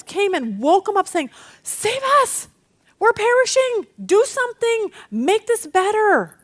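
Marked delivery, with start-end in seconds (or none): 1.6-6.4 high-pitched, urgent pleading; acted panic. Disciples waking Jesus in the storm, acted panic. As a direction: cry out for rescue in hammed-up alarm.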